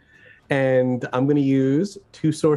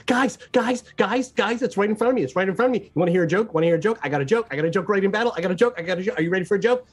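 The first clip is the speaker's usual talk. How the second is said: eager, pestering character babble, pitched up; rapid-fire repeats building to a high final cry; excited yell to friends; 5 semitones higher, much faster than usual. Acted. Carrot yells to friends mid-battle, excited babbling. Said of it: "then I yell to my friends".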